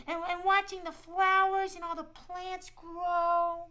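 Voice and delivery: put-on a friendly shy squirrel talking to kids; squirrel puppet voice. Squirrel puppet Shiloh tells the kids about winter, high cheerful voice.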